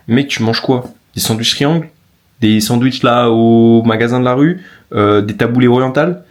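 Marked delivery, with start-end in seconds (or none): none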